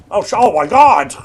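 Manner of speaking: normal talking voice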